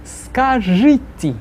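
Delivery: slow, drawn-out model word; slow repeat-after-me. Shown as a demonstration. Teacher counts the class in to repeat the word. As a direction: stretch it out, then cue the class. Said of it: "три-четыре".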